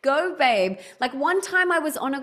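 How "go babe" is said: cheering on. Retold or imitated. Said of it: "everyone's gonna be like".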